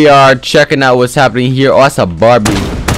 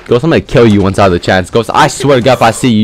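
usual speaking voice

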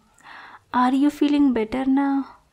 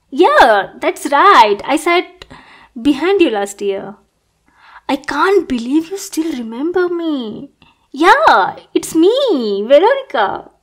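eager reveal; 3 semitones higher, 7 dB louder than usual. Acted. Revealing herself to classmate.

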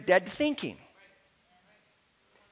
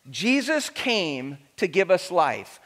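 usual speaking voice

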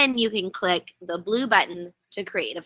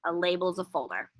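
softer, lower repeat of the point; quiet restatement; 3 semitones lower, 6 dB quieter than usual. Shown as a demonstration. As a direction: let it sink in quietly. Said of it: "Let's pause on that for a second".

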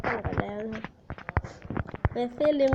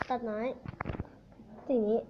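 keeping it quiet; 6 dB quieter than usual. Real, not acted. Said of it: "จะเงียบๆหน่อยนะครับ".

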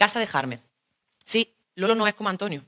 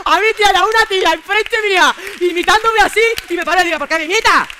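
excited retelling; 9 semitones higher, 10 dB louder than usual. Real, not acted. Retelling mimicking woman, excited.

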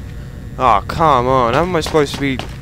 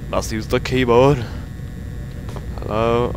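ordinary delivery

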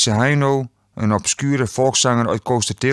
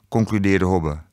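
ordinary delivery

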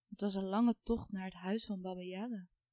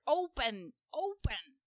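witch calling gate; 4 semitones higher, slower than usual. A narrator's reading. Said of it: "Ze riep tegen het hek".

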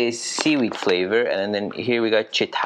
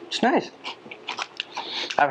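speaking between bites of chips; talking while chewing chips; faster than usual. Real, not acted. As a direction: mouth partly full of chips. Seen 0: eats chips before and throughout, bites another near end.

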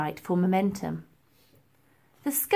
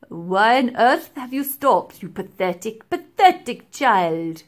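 put-on slow, louder sneering old man; scornful professor; 7 dB louder than usual. A narrator's reading. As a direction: drag out the scorn on pathetic. Professor scolds stalled girl.

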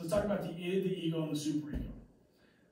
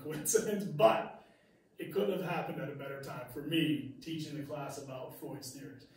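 ordinary talk

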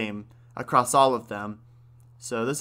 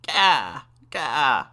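loud, rasping raven caw; a raven's cry from a baby; raven-like baby wail; 5 dB louder than usual. A narrator's reading. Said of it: "he wailed in Raven's rasping voice".

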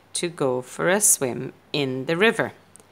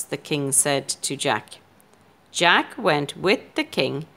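ordinary delivery, nothing marked

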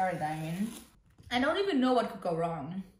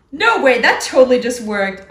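loud, delighted disbelief; excited outburst; 3 semitones higher, 12 dB louder than usual. Real, not acted. Crafter thrilled her taping trick held, loud excited cry. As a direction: burst out thrilled it worked.

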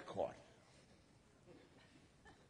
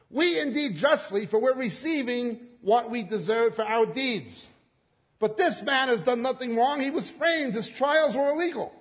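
higher and louder, indignant defence; rebuke; 8 semitones higher, 7 dB louder than usual. Acted. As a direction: scold the other thief sharply. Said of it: "rebuking him".

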